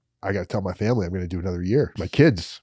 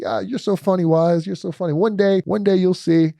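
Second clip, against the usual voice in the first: higher, playful friend voice, slowing at the end; teasing bunkmate; 9 semitones higher than usual. Retold or imitated. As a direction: tease him knowingly, voice pitched up. Josh teasing, voiced in story.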